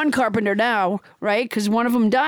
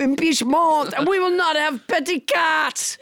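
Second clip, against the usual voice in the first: blustering mock-French proclamation; French Trump; 2 semitones higher than usual. French Trump threatens Biden and Pelosi, blustering French accent. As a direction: declaim it with absurd French vowels. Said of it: "your extremely realistic accent".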